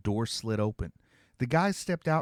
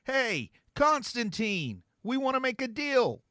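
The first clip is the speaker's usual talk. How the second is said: high, slow call into the dark; 12 semitones higher than usual. A narrator's reading. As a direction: shout it across the plaza, hands cupped. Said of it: "cupped his hands ... and shouted".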